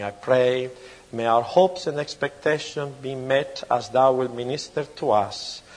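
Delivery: normal talking voice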